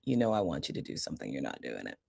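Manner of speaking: put-on low, quiet warning to a child; understated, low and hushed reprimand; stern parental look. Parent warning a son who is not listening, stern. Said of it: "I kinda give them that look".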